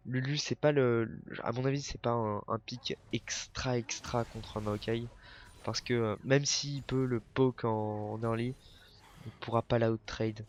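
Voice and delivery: ordinary talk, nothing marked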